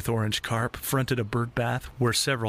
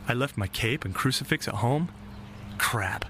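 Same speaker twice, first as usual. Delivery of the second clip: plain reading voice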